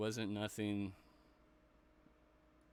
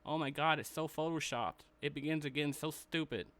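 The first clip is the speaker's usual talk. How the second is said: mocking whiny voice; 5 semitones higher, 4 dB louder than usual. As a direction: whine it like a pouty star. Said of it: "You don't go and see Sasha Banks going".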